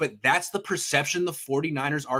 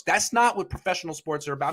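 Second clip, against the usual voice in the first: usual speaking voice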